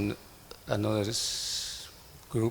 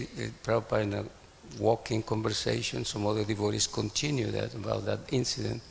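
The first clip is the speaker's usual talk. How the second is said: ordinary talk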